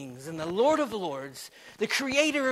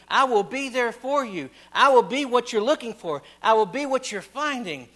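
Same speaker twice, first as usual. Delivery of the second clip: louder, rising preacher's push; fervent pulpit preaching; 4 semitones higher, 7 dB louder than usual. Acted. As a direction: drive the point home from the pulpit. Preaching God's promise, loud.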